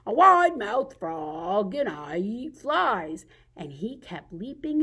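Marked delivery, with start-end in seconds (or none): 0.1-3.3 slow, stretched frog voice, big start; wide-mouthed frog. Acted. Frog jumping through the forest boasts, drawn-out frog voice. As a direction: drawl the boast with swooping vowels. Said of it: "and jumping and saying".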